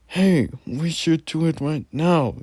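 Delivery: eager, high and bright; excited. Acted. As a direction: pitch it up with sudden eagerness. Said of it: "gleam of excitement".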